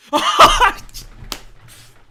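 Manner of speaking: wild high whooping laugh at the goal; ecstatic scream-laugh. Real, not acted. Fan screaming as his team scores, ecstatic shriek. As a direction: let out a shrieking laugh of joy.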